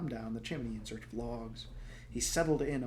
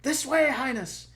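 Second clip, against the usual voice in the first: urgent call; 13 semitones higher, 8 dB louder, slower than usual. Acted. Servants urging royals to flee.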